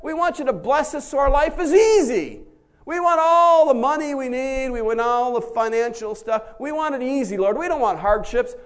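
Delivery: high, loud, pleading complaint to the Lord; mock whining petition; whiny prayer. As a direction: beg like a spoiled child. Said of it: "We say".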